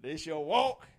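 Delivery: slow, loud preached reading; preaching scripture. Acted. Preacher reading Isaiah to the congregation, slow and loud. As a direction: lift the verse up slowly.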